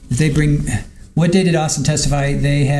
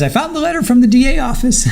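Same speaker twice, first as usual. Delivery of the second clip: much higher, imitating a thrilled witness; mock happy; 10 semitones higher than usual. Witness Sponge thrilled at finding the letter, mock happy and much higher. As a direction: squeal it out like she's delighted. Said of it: "She's so happy".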